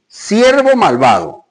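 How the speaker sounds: high, loud, slow rebuke; angry king. Acted. King rebukes wicked servant in a loud, slow fury. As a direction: thunder it out as the betrayed master. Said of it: "su señor enojado".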